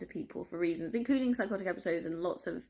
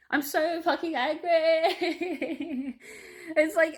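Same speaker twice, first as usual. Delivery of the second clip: high, very slow, half-laughing fury; angry laughing vent; 10 semitones higher, 5 dB louder, much slower than usual. Real, not acted. Venting to viewers about injustice, high, slow, laughing. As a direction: drag the words out, laughing through real anger.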